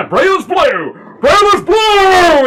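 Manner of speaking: high shout building into a long-drawn roar; rally the tavern with a rising bellow; battle cry. Acted. Lorcan brandishes axe to crowd.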